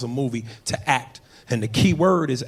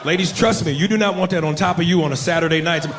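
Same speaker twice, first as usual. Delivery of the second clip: normal talking voice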